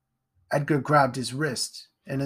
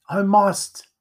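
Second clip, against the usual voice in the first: anguished resolve; 7 semitones higher, 6 dB louder than usual. A narrator's reading. Boy torn, must flee.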